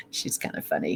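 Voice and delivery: a quiet whispered remark; whispered aside. Real, not acted. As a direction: whisper it with a chuckle. Amused aside about the spirit.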